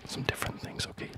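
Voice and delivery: ordinary talk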